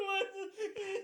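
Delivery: very high, loud whimper through laughter; mock crying. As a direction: play a tearful, confused wail while cracking up. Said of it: "I'm crying".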